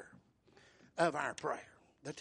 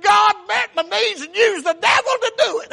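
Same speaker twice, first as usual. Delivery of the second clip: high, full-force pulpit shout; acted jubilant yell; triumphant shouting; 18 dB louder than usual. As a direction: shout it out in praise, very loud. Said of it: "she started shouting again".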